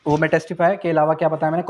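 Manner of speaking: ordinary talk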